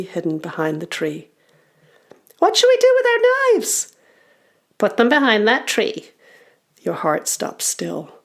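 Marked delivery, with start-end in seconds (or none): 2.4-3.9 very high, fast, loud squeaky goblin; goblin child. Acted. Young goblin asking about the knives, squeaky goblin voice. 4.8-6.1 higher, firm goblin voice; goblin leader. Acted. Older goblin answering the young ones, goblin leader voice.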